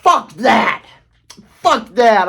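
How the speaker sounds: angry retold swearing at a church sign, punchy; mock angry outburst. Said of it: "I lashed out at it".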